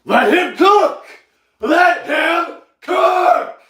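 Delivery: high, loud hype shout; yelling. Acted. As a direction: yell it like a hype man. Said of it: "who is yelling?".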